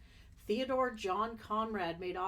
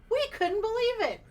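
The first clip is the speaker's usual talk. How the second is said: quoted woman voice; 13 semitones higher, 8 dB louder than usual. Pretend. Reading quote in a character voice.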